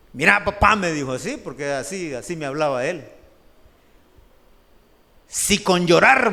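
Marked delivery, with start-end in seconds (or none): 0.1-0.7 boss calling out. Retold or imitated. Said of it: "Y me dijo". 5.3-6.3 boss scolding. Retold or imitated.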